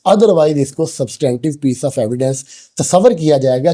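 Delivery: normal talking voice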